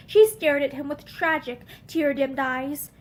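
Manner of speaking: ordinary delivery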